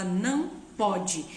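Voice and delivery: slow, firm single no. Shown as a demonstration. Parent stopping a child who hit, slow firm no. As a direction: draw the line slowly and firmly. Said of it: "fala com ela".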